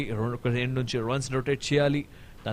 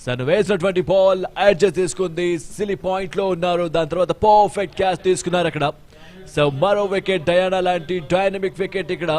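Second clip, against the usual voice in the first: raised live play-by-play; excited cricket commentary; 5 semitones higher, 5 dB louder than usual. Real, not acted. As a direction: call the wicket with energy. Live wicket call, raised voice.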